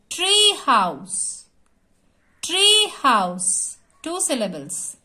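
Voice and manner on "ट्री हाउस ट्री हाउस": Tree house in two stretched, separate beats; syllable by syllable. Shown as a demonstration. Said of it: "एक एक word हमको जोर से बोलना है".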